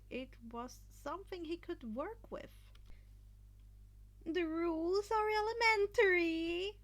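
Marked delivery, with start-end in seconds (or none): 4.2-6.8 excited Midnight. A narrator's reading. Midnight announcing the next event to the crowd, excited and loud. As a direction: savour each word like a thrilled host. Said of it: "Midnight started".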